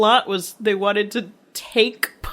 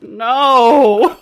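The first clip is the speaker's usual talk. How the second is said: loud, higher wail of disbelief; hammed-up protest at a petty flaw; mock outraged cry; 4 semitones higher, 9 dB louder than usual. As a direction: cry out as if personally wronged. Retold own outcry at movie error.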